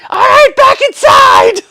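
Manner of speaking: high, loud, drawn-out holler; calling dogs in. Retold or imitated. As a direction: holler the dogs back indoors. Re-enacting calling the dogs inside.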